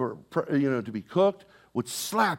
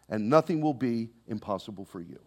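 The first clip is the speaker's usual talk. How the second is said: normal talking voice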